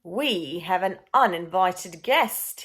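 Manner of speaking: a lightly voiced silky villain, loud first well; smug purring schemer. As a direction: savour the discovery like a sly cat. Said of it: "he purred".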